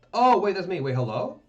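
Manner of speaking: normal talking voice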